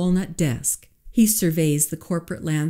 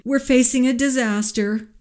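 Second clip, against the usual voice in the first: nervous assistant; 8 semitones higher than usual. A narrator's reading. Harry voiced, high.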